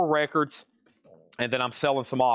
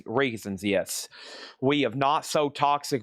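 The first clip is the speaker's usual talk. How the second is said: normal talking voice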